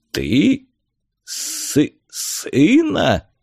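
halting, rising disbelief; stunned stammer. A narrator's reading. Helmsman learning Vagar has a son, stunned. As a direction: stumble over the word in shock.

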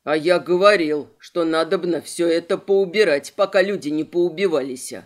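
loud grumbling. Acted. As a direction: grumble it loudly like an old man. Fat witness grumbles loudly.

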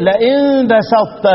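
लئن بسطت slowly with the ط merged into the ت; drawn-out recitation of لئن بسطت with full idgham; tajweed demo. Shown as a demonstration. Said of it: "فلما نيجي نقول إيه".

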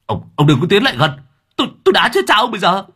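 panicky threat. A narrator's reading. Driver Hà bluffing about martial arts, panicked. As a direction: bluff bravely while terrified.

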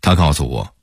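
usual speaking voice

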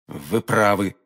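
ordinary delivery

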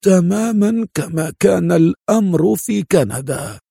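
mock mumble, fully voiced; low-voiced mumble. A narrator's reading. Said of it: "وغمغم في صوت خافت".

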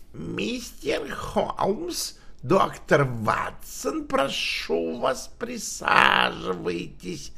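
slow, breathy dying rasp; death rattle voice. Acted. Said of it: "голосом, напоминающим предсмертный хрип".

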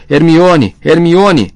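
loud, high bellow, repeated; desperate bellowing. A narrator's reading. Rony screaming for tortured Hermione, desperate bellowing. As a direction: bellow the name with all your force. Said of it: "Para de berrar".

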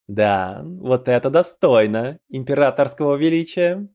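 acted venomous snort of approval; venomous scoff. A narrator's reading. Травма remarking on the emperor's new mount, venomous scoff. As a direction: sneer it in a lightly put-on character voice. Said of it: "Ядовито хмыкнула Травма".

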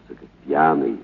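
ordinary talk, nothing marked

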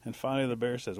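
ordinary delivery

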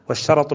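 والشرط as "wash-sharat", ra with fatha; والشرط pronounced "sharat", not closed up. Shown as a demonstration. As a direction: say والشرط with "a" after the ra. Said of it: "بفتح الراء".

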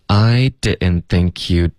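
slow, evenly stretched linked model for learners; slow practice. Shown as a demonstration. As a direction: model it slowly, sounds run together. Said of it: "第一段我们可以练的就是". (慢速连读示范)

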